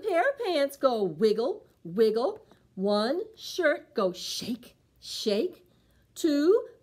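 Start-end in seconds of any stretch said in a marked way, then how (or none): none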